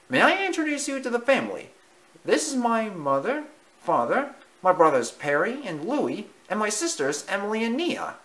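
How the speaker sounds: high, fast eager mouse; proud mouse voice. Acted. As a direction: show off the family proudly. Timothy introduces family.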